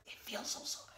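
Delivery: hushed unvoiced whisper; soft whisper. Real, not acted. Praising the sweater to viewers, whisper. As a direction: whisper the softness.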